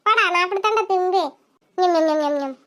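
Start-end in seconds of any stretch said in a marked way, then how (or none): 1.8-2.6 mock munching sounds, low; smacking chewing noise like a greedy eater. Loud chewing while eating peanuts.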